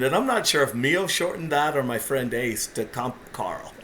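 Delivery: ordinary talk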